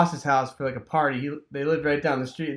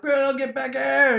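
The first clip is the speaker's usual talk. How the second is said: higher, calling out after a runaway dog; 10 semitones higher than usual. Retold or imitated. Boy chasing the escaped dog down the street, calling out. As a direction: yell for the dog to come back.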